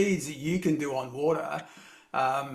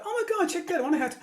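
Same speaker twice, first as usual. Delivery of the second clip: much higher, mock-amazed gasp at flowers; delighted; much faster than usual. Driver at the pits marvelling at flowers, high mock wonder. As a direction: play it as a driver thrilled by tiny flowers. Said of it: "He's like".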